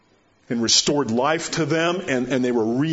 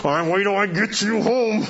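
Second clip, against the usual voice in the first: dad's threat hissed through clenched teeth; 7 semitones higher than usual. Retold or imitated. Preacher imitating an angry dad scolding his kid, teeth clenched. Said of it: "Talking with your teeth clenched".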